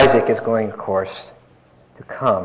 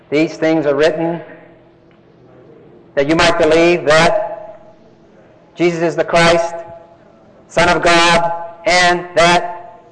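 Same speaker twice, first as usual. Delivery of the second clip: scripture recitation; 2 semitones higher than usual. Acted. Quoting John's Gospel.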